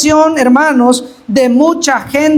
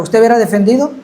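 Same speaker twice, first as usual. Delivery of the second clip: ordinary talk, nothing marked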